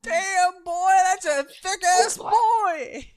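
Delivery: loud, high, drawn-out amazement; hyped exclamation. Acted. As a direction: yell it out in awe. Gawking at huge mirror.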